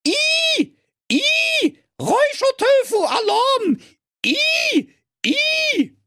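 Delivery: very high, shrill-toned alarm shouting; a beeping alarm yelling out; smoke alarm voice. Acted. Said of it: "Und der Rauchmelder sprach".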